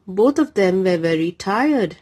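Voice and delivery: ordinary delivery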